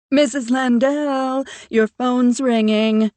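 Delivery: loud, slow call from across the room. Acted. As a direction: call out to her as you hurry over. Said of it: "Noé calls as he rushes".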